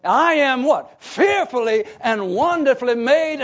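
loud, high preaching crescendo; exultant proclamation. Acted. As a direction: proclaim it with full conviction, stretching the opening. Preacher proclaims John's self-assurance.

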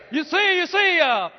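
high and loud, carried by the shout; fired-up preaching. Acted. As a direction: keep the fire going. Stays fired up after shout.

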